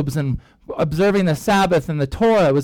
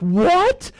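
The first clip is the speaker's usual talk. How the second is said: high, rising disbelieving what; utter shock; 10 semitones higher than usual. Retold or imitated. The elders react to Peter's news, high shocked. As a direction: play stunned disbelief. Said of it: "this utter shock, like".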